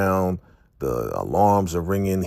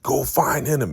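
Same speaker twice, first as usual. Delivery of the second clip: higher-pitched furious command; enraged leader ordering the hunt; raging order; 9 semitones higher than usual. Retold or imitated. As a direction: bark it while throwing chairs. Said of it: "He's throwing chairs".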